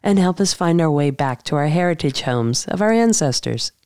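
measured, scripted host narration with deliberate pauses; podcast intro read. Acted. Host introducing today's guest to listeners, measured scripted read. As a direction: read it as a polished show introduction, phrase by phrase.

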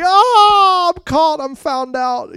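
high, loud wail, easing off; hammed-up cry of dismay; acted dismay at being caught. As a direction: wail it like a culprit exposed. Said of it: "he flips it on him, and he's like".

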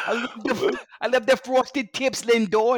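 high, slow Boston heckle; Boston accent. Acted. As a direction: taunt in a mock Boston accent. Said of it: "I have no idea why a Boston accent".

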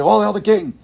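ringing herald-like cry; hailing a king. Acted. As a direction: proclaim it to royalty. Acted subject hailing the king.

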